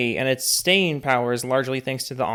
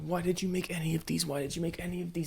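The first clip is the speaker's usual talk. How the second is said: small, high, soft pleading; mock despairing whine; 4 semitones higher, 5 dB quieter, faster than usual. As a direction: whine it like a child begging to stop. Begging not to watch the rest.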